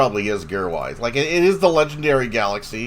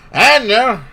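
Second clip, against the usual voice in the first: high silly voice; 9 semitones higher, 5 dB louder than usual. Pretend. Repeats in a silly voice.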